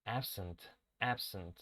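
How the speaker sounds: Absent with a sharp, unvoiced s. Shown as a demonstration. Teacher corrects absent to a plain s sound. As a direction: say absent with a hissing s, softly. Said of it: "Es con S".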